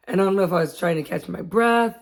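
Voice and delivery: ordinary talk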